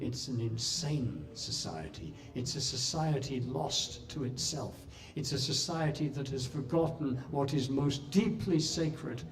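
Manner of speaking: hushed inner verdict. Retold or imitated. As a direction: say it softly to yourself, as if thinking. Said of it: "in my mind, I was going".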